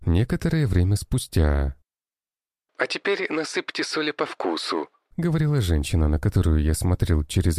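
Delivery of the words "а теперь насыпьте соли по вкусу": recipe video woman. A narrator's reading.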